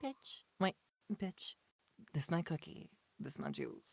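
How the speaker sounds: lightly voiced sassy diva, high then dropping; a hint of a sassy girl; man doing a woman's voice. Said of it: "she was like".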